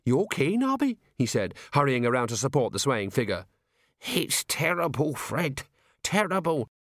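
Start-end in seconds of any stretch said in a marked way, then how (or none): 0.0-1.0 high, startled question; shocked concern for a friend; alarmed gasp. A narrator's reading. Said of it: "looked up from the desk and gasped". 4.0-6.7 slow, higher-pitched moan; pitiful character voice, drawn out; woeful lament. A narrator's reading. Shaken Nobby laments to his friend.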